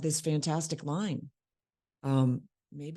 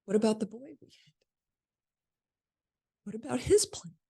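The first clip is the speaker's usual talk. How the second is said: higher-pitched, halting plea with a long pause; self-serving plea; 6 semitones higher, faster than usual. Acted. Actress steals the scene for herself, self-serving higher plea. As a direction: make it all about you, voice lifted. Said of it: "now it's all about me".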